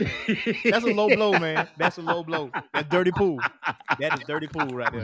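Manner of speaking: laughing mock outrage. Real, not acted. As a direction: protest through laughter.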